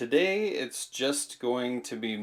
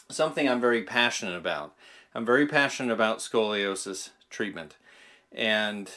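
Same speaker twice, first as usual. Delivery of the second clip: usual speaking voice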